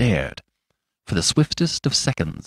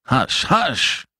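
urgent hush; 4 semitones higher than usual. A narrator's reading. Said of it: "exclaimed the doctor".

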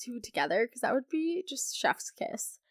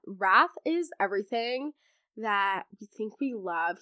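ordinary talk, nothing marked

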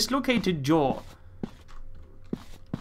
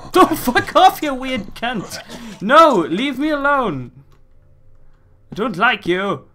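higher and louder, yelling in fright; panicked shout; 9 semitones higher, 9 dB louder, slower than usual. Real, not acted. Player screaming at a lunging monster. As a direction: yell at it to leave you alone.

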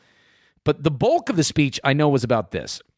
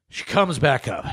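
ordinary talk, nothing marked

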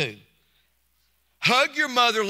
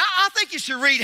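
ordinary talk

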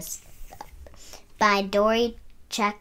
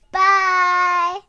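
high, loud, bright farewell shout; excited bye; 12 semitones higher, 12 dB louder than usual. Real, not acted. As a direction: call out a big cheerful goodbye. Cheerful sign-off to viewers.

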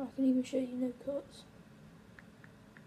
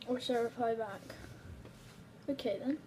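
usual speaking voice

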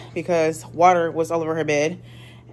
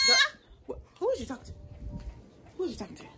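soft aside; 11 semitones higher, 10 dB quieter, much faster than usual. Real, not acted. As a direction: ask it gently, far under your usual volume. Murmured aside to the baby.

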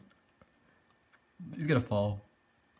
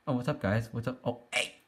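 ordinary talk

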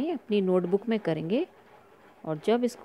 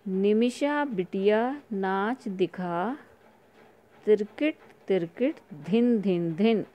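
slow read-along; slower than usual. Shown as a demonstration. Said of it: "तो ये पढ़िए मेरे साथ।".

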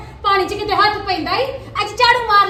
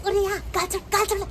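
soft, secretive beckon to come hear a secret; hushed aside; 6 dB quieter, faster than usual. Acted. Old woman hushing her neighbour to share gossip, hushed. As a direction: draw her close in a hushed voice.

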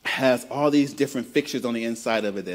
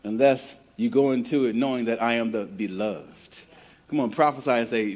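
normal talking voice

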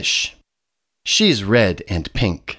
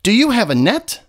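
1 hurried chicken; 12 semitones higher, 3 dB louder, faster than usual. A narrator's reading. Chicken asks fish urgently.

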